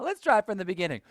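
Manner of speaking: normal talking voice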